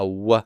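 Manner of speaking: "W" as a slow, short-vowelled "wuh"; earlier Hebrew W. Shown as a demonstration. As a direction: round the lips and say "wuh" for "W". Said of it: "would have been pronounced as a W sound".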